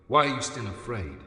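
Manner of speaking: ordinary talk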